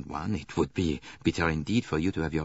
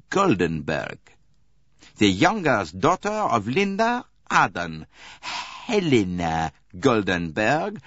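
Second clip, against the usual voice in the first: slow, deliberate detective unmasking her, higher and louder; Poirot, triumphant; 5 semitones higher, 5 dB louder, slower than usual. Acted. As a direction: lay out the truth piece by piece, savouring it. Poirot voicing, confronting the Countess.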